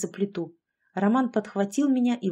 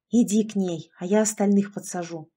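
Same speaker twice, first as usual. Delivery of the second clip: ordinary delivery, nothing marked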